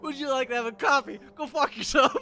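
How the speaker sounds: loud, high, fast, laughing retort; mock rude reply. Dog walker mocking the podcast with a rude reply. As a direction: throw back the line with a laugh. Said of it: "I'm like".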